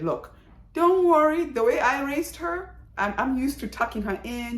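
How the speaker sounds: lightly voiced mom brushing off worry; mother's voice. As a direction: put on the confident mother who says don't worry. Mother quoted reassuring about daughter.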